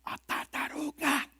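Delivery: soft, high, drawn reveal; whisper-like reveal of the turtle; hushed reveal. Acted. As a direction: narrator revealing the turtle softly. Naming the slow turtle.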